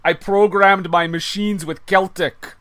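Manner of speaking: loud, slow hammed-up Scottish villain; a proud Scottish villain, drawn out and boomed; mock Scottish accent. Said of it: "doing a terrible Scottish accent".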